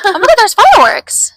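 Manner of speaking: high, loud burst of delight; real excited surprise. Real, not acted. Friend interrupting her story at seeing fireworks, loud excited surprise. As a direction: blurt it out as you suddenly spot them.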